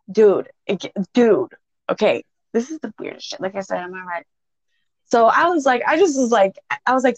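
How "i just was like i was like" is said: ordinary delivery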